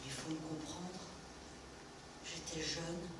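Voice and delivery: quiet confession. Acted. Quiet confession to her child.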